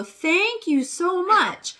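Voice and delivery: high, slow, overjoyed thanks; gushing praise. Retold or imitated. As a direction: lay the praise on thick for the bird. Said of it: "And I'd go".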